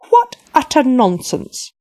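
slow, loud scornful rebuke; indignant outburst. Acted. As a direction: dismiss it with royal scorn.

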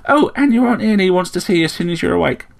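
chirping sparrow. A narrator's reading. Sparrow fusses over Slayle's injuries, chirping. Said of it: "chirped the sparrow".

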